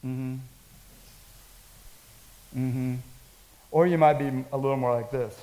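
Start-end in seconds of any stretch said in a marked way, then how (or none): 0.0-3.0 low, quiet, understated murmur; soft, deadpan mm-hmm; stoic response. Acted. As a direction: hum it back impassively, barely reacting. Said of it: "a stoic responsive person".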